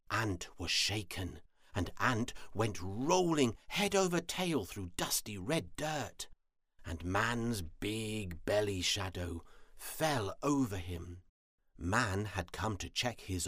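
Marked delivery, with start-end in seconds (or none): none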